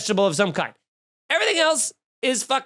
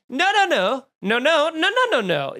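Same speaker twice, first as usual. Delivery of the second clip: emphatic denial; 7 semitones higher than usual. Real, not acted. Comedian refusing the swap, climbing in outrage. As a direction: pile on the nos, climbing.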